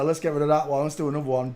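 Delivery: ordinary talk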